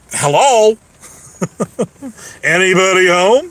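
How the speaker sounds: teasing "is anyone there" call; mock calling out. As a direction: call out as if knocking on an empty head. Mock calling out to absent listeners.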